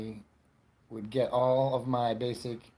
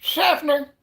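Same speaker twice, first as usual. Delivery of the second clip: very high, loud mock cry; exclaimed town name; 11 dB louder than usual. Exclaims town name after coffee.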